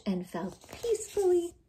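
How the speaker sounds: gentle bedtime whisper. A narrator's reading. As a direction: breathe it out as they sleep. Story ends in sleep.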